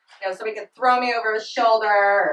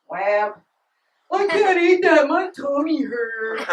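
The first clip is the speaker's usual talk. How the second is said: put-on higher, childish complaining; mock whiny boyfriend; 4 semitones higher, 4 dB louder, slower than usual. Boyfriend refusing dinner, mock whiny complaint. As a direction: whine it like a fussy eater.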